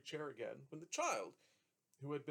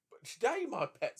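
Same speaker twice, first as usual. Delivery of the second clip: put-on fond old father; 8 semitones higher, 8 dB louder than usual. A narrator's reading. Trotty voiced, gentle tease.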